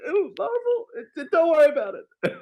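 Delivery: very high, squeaky hammed-up babbling; loud high burst, easing off in volume; mock freak-out. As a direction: squeal it out, too overcome to explain. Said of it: "I'm, like, freaking out".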